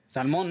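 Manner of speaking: salmon as Spanish "sal-MON"; salmon with Spanish vowels, stress at the end; Spanish pronunciation. Shown as a demonstration. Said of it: "How do you say it in Spanish".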